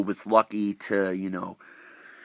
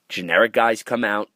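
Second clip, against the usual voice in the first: normal talking voice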